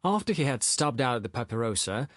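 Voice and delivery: plain reading voice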